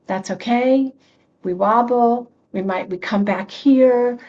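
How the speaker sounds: usual speaking voice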